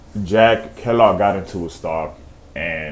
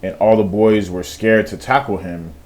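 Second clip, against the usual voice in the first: normal talking voice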